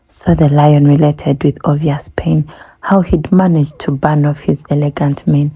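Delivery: usual speaking voice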